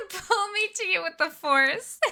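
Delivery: high, dreamy mock-romantic plea; lightly hammed-up swoon to Obi-Wan. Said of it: "just saying things like".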